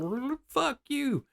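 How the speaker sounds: high, slow mocking replay of his own cussing; mimicking the tape recorder's playback, high and slow; mock taped voice. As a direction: squeak out the tape's fuck you.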